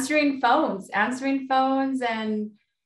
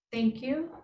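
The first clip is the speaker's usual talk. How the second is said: right in your ear. Acted. Guided breathing intention.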